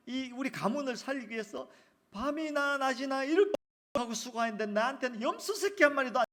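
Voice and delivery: loud, high, indignant complaint to his father; elder son's angry protest. Acted. Elder son protesting loudly to his father, resentful.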